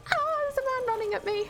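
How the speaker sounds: high, fast cry of alarm; panicked. Real, not acted. Player charged by an enemy, panicked. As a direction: blurt it out in a rush of panic.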